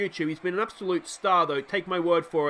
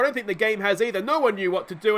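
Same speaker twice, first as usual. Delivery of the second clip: ordinary delivery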